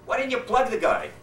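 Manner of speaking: high, exasperated questioning; upset sergeant. Acted. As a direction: demand an answer, baffled. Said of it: "The sergeant's really upset".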